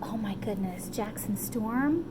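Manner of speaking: ordinary delivery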